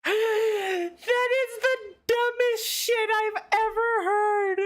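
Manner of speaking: high, stretched-out disbelieving cry; incredulous outburst. Real, not acted. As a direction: exclaim it in total disbelief. Reacting to absurd game excuse.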